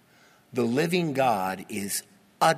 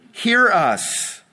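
pleading cry; 7 semitones higher, 6 dB louder than usual. Acted.

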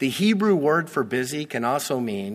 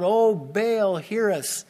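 mock crying out; 7 semitones higher, slower than usual. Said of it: "and they're crying out".

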